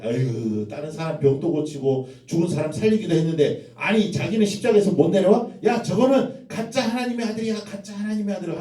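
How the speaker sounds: high, jeering taunt; mocking. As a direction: sneer at him from the crowd. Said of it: "하면서 예수를 막 조롱하는 거예요".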